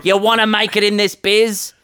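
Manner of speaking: 3 preach showbiz wisdom like a pushy producer; 1 showbiz mentor voice. Retold or imitated. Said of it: "learned nothing from Daryl".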